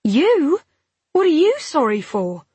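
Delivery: high, incredulous questioning; astonished. A narrator's reading. Woman hearing her partner apologise, astonished. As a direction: question him in disbelief.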